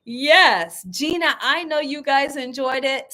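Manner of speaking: ordinary talk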